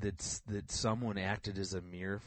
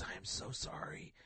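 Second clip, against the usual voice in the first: whispered apology; 6 dB quieter than usual. Retold or imitated. Mentor voiced in retold call.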